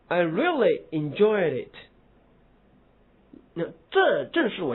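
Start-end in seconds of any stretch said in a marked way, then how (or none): 0.1-1.7 slow, really pressed; stress on really. Shown as a demonstration. Teacher stressing really in example. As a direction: lean on really. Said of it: "重读一下子".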